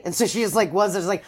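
normal talking voice

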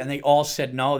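normal talking voice

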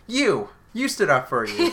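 high, loud, punchy "You!"; spring the answer like a punchline; emphatic reveal. Retold or imitated. Imitating the doctor's big reveal about who stood up, loud and high. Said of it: "waiting to see if you would say it like that".

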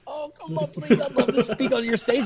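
high-pitched, comic begging; pleading inner voice. Retold or imitated. Secretly begging to speak on the stage, hammed-up pleading. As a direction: beg in a squeaky, hammed-up inner voice. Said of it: "Inside, I was like".

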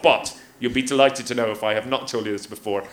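ordinary talk

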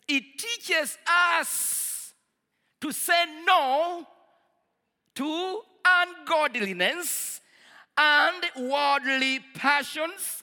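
slow, loud emphatic scripture reading; preaching at full force. Real, not acted. Preacher proclaiming scripture to the congregation. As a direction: hammer out each phrase slowly.

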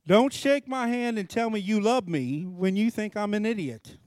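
ordinary talk

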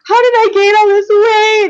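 high, loud mock-hysterical wail; retold freak-out. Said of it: "I'm, like, freaking out".

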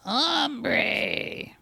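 high, strained grizzled cowboy. Pretend. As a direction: drag the word out, weathered and rough. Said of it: "do it in a grizzled voice".

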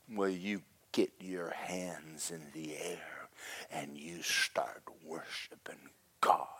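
slow, hushed command through the teeth; low whisper, every word drawn out; menacing order. Retold or imitated. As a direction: imitate a fierce woman's whispered threat. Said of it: "fire flies out of her eyes".

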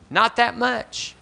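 wry downplay. Real, not acted. High, slow and wry.

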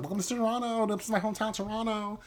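high imitation, "Toronto" run together like "Toranno"; chirpy imitation of her casual Toronto; bright tourist-guide mock voice; mock Tanya on the bus. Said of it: "she's like".

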